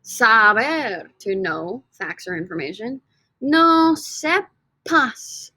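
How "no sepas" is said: "No sepas" drawn out very slowly, high; slow model. Shown as a demonstration. Teacher modelling "No sepas" very slowly. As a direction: stretch "No sepas" so the P is heard. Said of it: "the B change it to a P".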